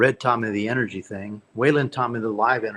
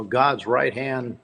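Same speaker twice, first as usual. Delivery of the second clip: ordinary delivery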